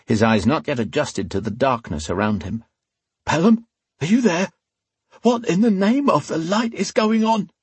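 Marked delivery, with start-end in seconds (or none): 3.2-7.5 high-pitched, rattled henchman voice; nervous thug, alarmed. Acted. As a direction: call out in mounting alarm for a missing partner.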